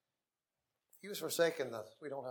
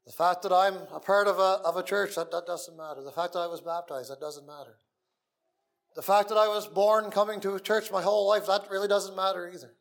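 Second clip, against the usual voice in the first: raised, louder preaching voice; fiery preaching; 3 semitones higher, 7 dB louder than usual. Acted. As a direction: preach it out with full force. Preaching what doesn't matter.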